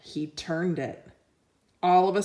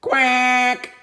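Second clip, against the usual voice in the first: louder, higher quack; yelled quack; 5 semitones higher, 7 dB louder than usual. Acted. Duck yelling as Sheep leaps aboard. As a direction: shout a quack. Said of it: "yelled Duck".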